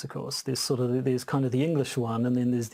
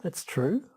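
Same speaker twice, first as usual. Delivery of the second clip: higher, rising end on true; rising terminal; 4 semitones higher than usual. Shown as a demonstration. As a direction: copy the uptalk, lifting TRUE high. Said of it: "No, you should say".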